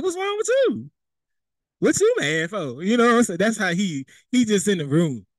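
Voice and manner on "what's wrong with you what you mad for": high teasing question. Retold or imitated. Walking in on a sulking friend, high mock concern. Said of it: "you like".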